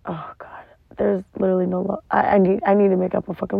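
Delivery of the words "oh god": breathy whispered aside. Real, not acted. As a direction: whisper it, overcome.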